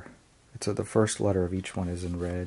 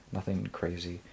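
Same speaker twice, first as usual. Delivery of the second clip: ordinary talk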